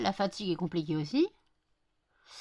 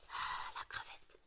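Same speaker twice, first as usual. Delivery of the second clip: murmuré lentement, tout bas; souffle-le tendrement; chuchoté; 15 dB quieter, slower than usual. Real, not acted. Chuchote au chien. (chuchotement tendre au chien)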